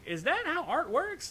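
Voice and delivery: incredulous question. Retold or imitated. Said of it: "And I'm like".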